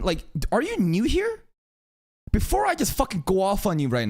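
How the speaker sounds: exasperated rant. Real, not acted. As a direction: go off on them, incredulous. Ranting at live chat viewer.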